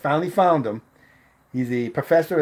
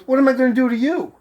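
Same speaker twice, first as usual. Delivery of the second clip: high, loud, quick mock protest; retold protest; 12 semitones higher, 5 dB louder, faster than usual. Girl wanting a ride pleads harmlessness, in high quick protest. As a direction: imitate her arguing, fast and pitched up.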